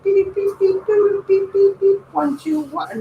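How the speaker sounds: high beeping robot voice. Pretend. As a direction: beep it out like a toy robot. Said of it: "hear him talking to us".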